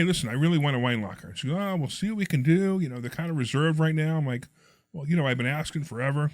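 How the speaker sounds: ordinary talk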